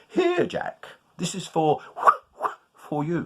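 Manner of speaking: high airy giving voice; North Wind. Acted. North Wind gives the cloth.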